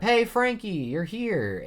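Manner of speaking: high, loud, delighted call-out; excited greeting. Acted. Lilith calls out to Frankie on arrival, excited. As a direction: pitch it way up, calling to a friend.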